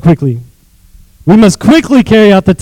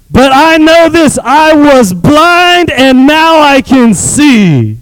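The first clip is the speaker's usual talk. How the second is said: slow, soaring testimony; preached climax; 8 semitones higher, much slower than usual. Acted. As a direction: stretch it out as a triumphant proclamation.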